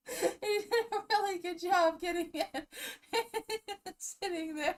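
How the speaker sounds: high, crying with laughter; barely holding it together; real laughter about the video; laughing through tears. Real, not acted. Laughing fit.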